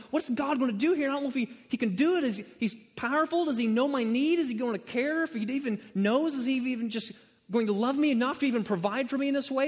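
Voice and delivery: high, fast anxious questions tumbling out; panicked worrier. Retold or imitated. Preacher imitating a panicking unbeliever, high and rushed. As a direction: voice the frantic doubts of a panicking believer. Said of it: "freaking out, going".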